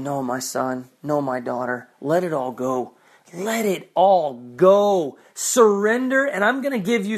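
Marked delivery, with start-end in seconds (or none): 0.0-5.2 voicing God tenderly. God telling his children to let go, tender and lower. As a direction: gently coax them to let go. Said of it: "When God is saying".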